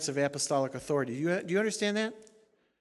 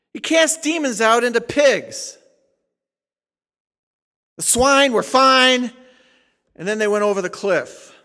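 preaching at full force; 2 semitones higher, 11 dB louder than usual. Acted. Pastor driving home his point to the congregation, fiery preaching. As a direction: thunder it from the pulpit.

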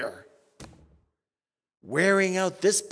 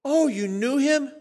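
higher incredulous question; mock surprise; 8 semitones higher, 4 dB louder than usual. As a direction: ask in feigned amazement. Pastor questioning the weeper.

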